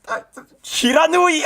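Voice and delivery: high excited yell of the attack name; excited shout. Real, not acted. Streamer reliving the movie's big attack, excited shout. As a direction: burst out with the attack name, thrilled.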